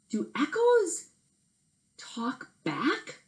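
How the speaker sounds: high, slow baffled question; astonished the echo spoke; high squeaky amazement; puzzled surprise. Acted.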